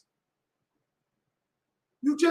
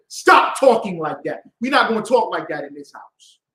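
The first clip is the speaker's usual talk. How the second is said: loud, firm command; stern king of the house; 4 semitones lower, 7 dB louder than usual. Retold or imitated. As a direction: lay down the law as head of household. Acts king laying down house rules.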